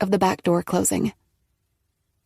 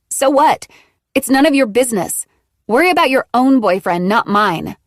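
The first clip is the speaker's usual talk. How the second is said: defiant; 5 semitones higher, 6 dB louder than usual. A narrator's reading. Maggie defiantly snapping back.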